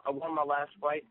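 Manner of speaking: normal talking voice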